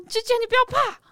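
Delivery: very high, urgent little-sister voice; high-pitched, retold plea; protective younger sister. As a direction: imitate a sister rushing in to reassure. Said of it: "她就冲过来抱住我说".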